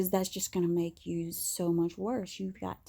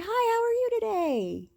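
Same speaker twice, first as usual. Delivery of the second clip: bright, high customer-service voice; fake cheerful greeting; 4 dB louder than usual. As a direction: put on a chirpy high hello despite the tears. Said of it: "and I'd be like".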